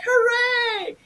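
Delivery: high squeaky hooray; theater actors cheering his news; mock cheering crowd. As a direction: cheer like the whole room.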